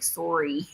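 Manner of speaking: "Sorry" said softly as "sore-ee"; a soft Canadian "Sorry", first vowel rounded. Retold or imitated. Imitating a friend's needless apology, soft Canadian "Sorry". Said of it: "She'll be like".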